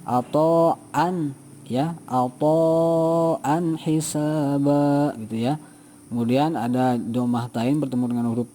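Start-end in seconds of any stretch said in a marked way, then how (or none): 0.0-5.6 slow chanted reading, "an hisaba" with a clear n; Quran recitation voice. Shown as a demonstration. Teacher reciting the example in a chanting voice. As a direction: intone it like Quran recitation, slowly. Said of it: "Sama, harus jelas ya".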